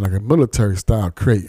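usual speaking voice

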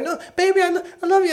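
very high, wheedling mock plea; high-pitched mocking baby-talk plea; pleading girlfriend. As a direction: coo it like a caught cheater. Girlfriend pleading in Saw story.

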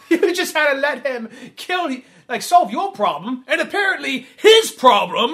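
high, loud laughing mockery; half-shouted sarcastic jab; mocking sarcastic voice. Mocking voice continues.

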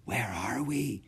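hushed whispered question; voiceless, secretive query. A narrator's reading. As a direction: whisper it cautiously. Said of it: "Henry whispered in turn".

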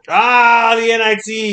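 loud, high teasing shout; mock outcry. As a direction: shout it high to rib the fans. Ribbing fans about a lesser tournament.

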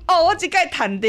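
a mother gleeful at three kisses; delighted mother. Retold or imitated. Mother thrilled after her daughter's kisses, delighted. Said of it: "我妈妈就太高兴".

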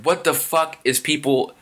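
retold indignant outburst, drawn out; furious disbelief. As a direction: replay your baffled anger, slowly. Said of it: "I was furious".

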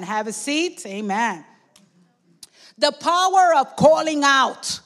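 ordinary delivery

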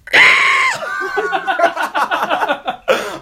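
Bane yell. Retold or imitated. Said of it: "Do your, do your best Bane yell".

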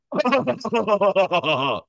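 a squeaky, high giggle imitating a guy; high shrill laugh impression. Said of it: "then he goes".

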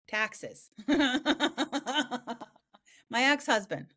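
joking through real laughter; words broken up by laughs; laughing. Real, not acted. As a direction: toss out the joke and crack up. Jokes about what else to forget.

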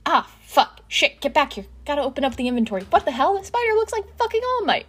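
high, loud streamer commentary; squeamish, high-pitched gaming panic; flustered gamer. A narrator's reading. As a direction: blurt out startled reactions mid-game. Gamer reacting in a recorded stream.

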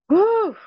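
a high, emotional blow-out of air; steadying breath before going on; emotional whew. Real, not acted. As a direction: exhale hard to keep from crying.

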